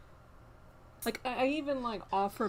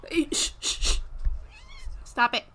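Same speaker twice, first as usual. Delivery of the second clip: higher, louder hey and stop it; loud scolding; 9 semitones higher, 8 dB louder than usual. Real, not acted. Streamer scolding her cat again, loud. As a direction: shoo the cat off, exasperated.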